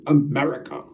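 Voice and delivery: loud, punched-out mock voice; imitated comedy-bit guy. Quoting the guy from Fluffy's bit, loud imitation. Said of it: "the guy's like".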